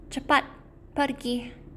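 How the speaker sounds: seru lebih tinggi dan keras; usir dengan ringan. Acted. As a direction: suruh cepat pergi. Lion sends mouse away. (singa mengusir tikus, seruan tinggi)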